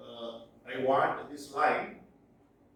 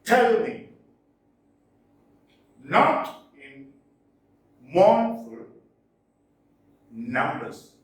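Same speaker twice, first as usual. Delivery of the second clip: loud, stressed reading of a verse line; teacher reciting a trochaic line; poem recital; 7 dB louder, slower than usual. Shown as a demonstration. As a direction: declaim each beat of the trochees. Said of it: "Let's look at an example".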